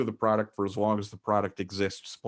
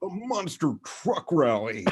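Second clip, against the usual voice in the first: monster truck ad; 7 semitones higher than usual. Pretend. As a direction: sell the rally like a TV spot. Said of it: "go ahead and say something like".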